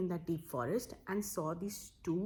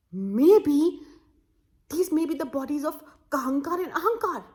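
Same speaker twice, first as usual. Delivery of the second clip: louder and higher, a sudden discovery; excited realization; 7 semitones higher, 9 dB louder than usual. Acted. Villager finding the bodies gasps in excitement. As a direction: blurt out the realization.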